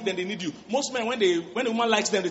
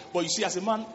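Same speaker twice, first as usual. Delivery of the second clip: ordinary talk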